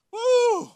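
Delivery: high loud woo; whoop. Real, not acted. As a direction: let out a big whoop. Vacation from vacation joke.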